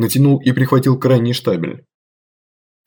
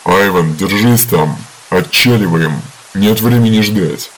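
lower, louder voice over earpiece radio; leaking from hidden earpiece; 3 semitones lower, 4 dB louder than usual. Acted. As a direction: shout through a headset radio. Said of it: "в наушнике послышался голос Глыбы".

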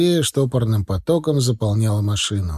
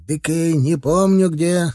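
slow, higher, abashed mumbled excuse; embarrassed; 5 semitones higher, slower than usual. A narrator's reading. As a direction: excuse yourself sheepishly, drawing it out. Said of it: "совсем уж сконфузился комбайнёр". (смущённо, медленно, выше голосом)

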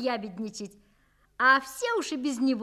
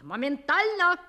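loud, higher barked command; angry shout; 4 semitones higher, 6 dB louder than usual. Acted. Father shouting at the maid, angry. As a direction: snap the order. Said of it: "Папа как крикнет".

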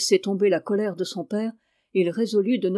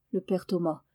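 ordinary delivery

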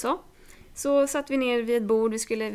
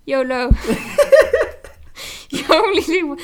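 laughing mock yolo; 5 semitones higher, 10 dB louder than usual. Mocking yolo cola drinker.

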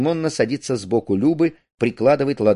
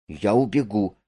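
usual speaking voice

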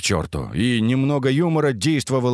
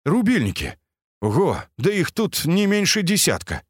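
surprised; 8 semitones higher than usual. A narrator's reading. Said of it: "удивился он".

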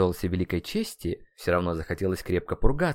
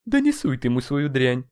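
raised, dismissive rebuke; higher, brusque telling-off; scolding friend; 4 semitones higher, 4 dB louder than usual. Acted. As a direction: tell a mate to back off. Companion scolds the drink offer.

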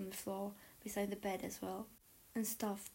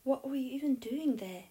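loud, high accusing question; raised-voice confrontation; demanding; 5 semitones higher, 8 dB louder than usual. A narrator's reading. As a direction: demand an explanation. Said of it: "Malfoy demanded".

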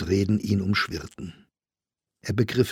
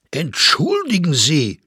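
raised, slow, insistent cry; shouting; 7 semitones higher, 6 dB louder, slower than usual. A narrator's reading. Staufner objects to Fräulein Gasteiner's denial, shouting. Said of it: "schrie Staufner".

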